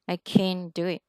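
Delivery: "can't" with the T stopped short. Shown as a demonstration. Teacher to student: "can't" with the T stopped short. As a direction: cut the T in "can't" with a quick stop. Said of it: "stop T á".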